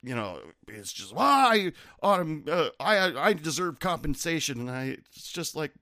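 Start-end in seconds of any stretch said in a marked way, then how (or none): 1.1-4.6 mock flustered customer. Flustered customer demanding compensation, high and loud. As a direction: sputter like the thrown customer.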